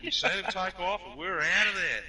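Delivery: high-pitched mock eyewitness report; breathless alibi. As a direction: spin the lie with wide-eyed urgency. Replaying their lie to the firemen.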